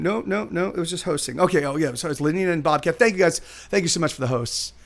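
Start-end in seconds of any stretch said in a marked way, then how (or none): none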